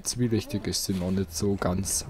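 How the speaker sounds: ordinary talk, nothing marked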